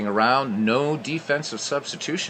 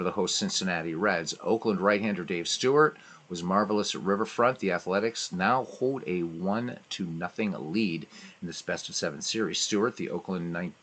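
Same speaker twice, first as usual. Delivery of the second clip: news recap read; 2 semitones lower, 5 dB quieter than usual. Acted. Reads the game recap.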